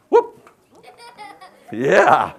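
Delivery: sudden high yelp, then a laughing yeah; surprised whoop. Real, not acted. Chef yelps when something slips, laughing. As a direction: caught off guard and laughing it off.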